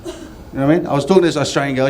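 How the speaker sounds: ordinary delivery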